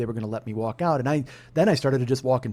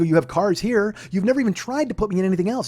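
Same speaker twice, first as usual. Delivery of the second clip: raised-pitch protest to a salesman; retold arguing; 7 semitones higher, 3 dB louder than usual. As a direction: press the complaint indignantly. Retold argument with car salesman.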